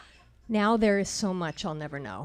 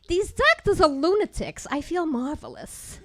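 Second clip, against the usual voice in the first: loud, high scoffing dismissal; 8 semitones higher, 6 dB louder than usual. Retold or imitated. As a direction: brush off the doctors with a scoff. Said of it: "she scoffed".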